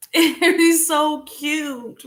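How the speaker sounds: high, loud, drawn-out gush; overwhelmed by cuteness. Real, not acted. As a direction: stretch it out, squealing. Gushing over cute cat.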